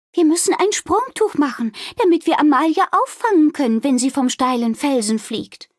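high-pitched, urgent storybook innkeeper; urgent innkeeper Wilma. Acted. Wilma explains the jumping sheet to Balduin, urgent. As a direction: explain a rescue plan, high and pressing.

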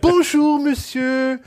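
very high, sweet Bonjour, monsieur; high mock-genteel madame; polite Parisian lady. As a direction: answer in a falsetto of exaggerated politeness. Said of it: "she'd be like".

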